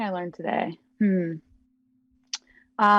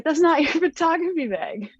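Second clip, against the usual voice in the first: 1 laughing disbelief; 9 semitones higher than usual. Retold or imitated. Roommate takes the wrong bag.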